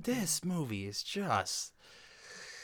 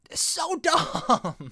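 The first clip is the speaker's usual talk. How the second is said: high, loud, drawn-out exasperation with a laugh; exasperated; 5 dB louder, much slower than usual. Real, not acted. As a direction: stretch it out in laughing disbelief. Exasperated at the movie's stupidity.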